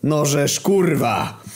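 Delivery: high, drawn-out enraged curse; livid, exasperated yell; furious shout. A narrator's reading. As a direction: swear at the top of your temper, stretched out. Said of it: "krzyknął Lewandowski wkurwiony na maksa".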